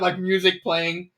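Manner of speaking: normal talking voice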